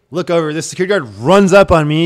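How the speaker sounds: usual speaking voice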